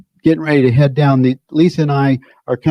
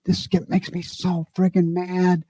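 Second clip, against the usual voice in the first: a lightly voiced frustrated grumble, softer; mock frustration; 4 semitones higher, 6 dB quieter than usual. Speaker voicing his own frustrated mind, muttered mock anger. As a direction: mimic the mind fuming under its breath.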